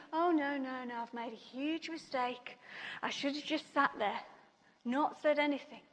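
hushed, low thought of regret; retold inner dread. Thinking to herself while climbing the slide, subdued dread. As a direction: think it aloud softly, sinking.

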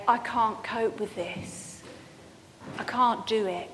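soft, low, defeated admission to God; hushed I-give-up prayer; quiet despairing plea. Retold or imitated. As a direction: let the words sink, beaten.

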